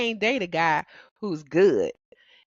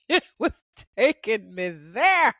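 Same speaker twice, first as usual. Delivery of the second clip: laughing; 4 semitones higher, 4 dB louder than usual. Real, not acted. Laughing about the song.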